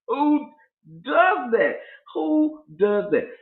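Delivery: high-pitched disbelief; incredulous. Real, not acted. Telling how she moved next building over, incredulous. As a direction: exclaim it in a high, drawn-out voice. Incredulous at neighbor moving nearer.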